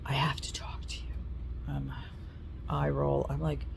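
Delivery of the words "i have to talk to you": hushed, secretive whisper; mother's whisper. Retold or imitated. Mother whispering to her grown child at noon. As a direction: whisper it like a mother with bad news. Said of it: "and she said".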